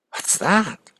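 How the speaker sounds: high-pitched, startled question; puzzled question. Retold or imitated. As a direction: ask in wonder, pitch leaping up. Retold Buddha startled by sick man.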